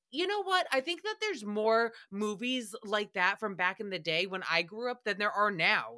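usual speaking voice

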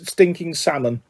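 ordinary delivery

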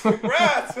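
high, loud crowd-style congrats; mock congratulatory cheer. Strangers congratulating a supposed new graduate, mock excited shout. Retold people congratulating the teller.

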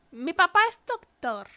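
put-on high, loud little kid voice; child voice. Kid asks injured man what he's doing, high child voice. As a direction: play a small boy greeting a stranger.

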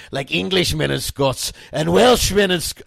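attempted Scottish accent, loud; a shaky-attempt Scottish character accent; Groundskeeper Willie. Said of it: "I'm just doing a Scottish accent".

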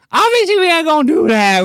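squeaky falsetto mockery; mock high voice. Mocking his own stream in a squeaky falsetto. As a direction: squeak it out like a silly cartoon.

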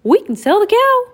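Mickey suddenly gets an idea; excited Mickey. Acted. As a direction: pop the idea out high. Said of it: "said Mickey".

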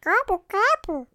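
high, loud cartoon turkey gobble; disguised gobble voice. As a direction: gobble like a creepy cartoon critter. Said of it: "Give us your best disguised gobble voice".

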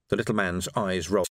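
usual speaking voice

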